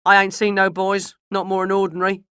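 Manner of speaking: loud rustic carter; Mister Miles. A narrator's reading. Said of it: "said Mister Miles".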